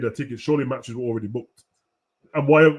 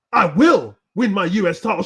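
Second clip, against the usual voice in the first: a bit louder mock wrestler boast; hammed-up promo voice; 4 semitones higher, 5 dB louder than usual. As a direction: overplay a cheesy title promise. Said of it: "pretend to do this stupid voice".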